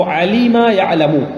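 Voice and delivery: علیم یعلم said slowly and clearly; slow verb-pattern drill; slow recitation. Shown as a demonstration. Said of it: "سمع یسمع والے باب".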